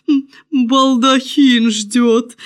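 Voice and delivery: drawn-out court herald; master of ceremonies. A narrator's reading. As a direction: report it grandly, drawing the words out. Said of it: "доложил обер-церемонимейстер".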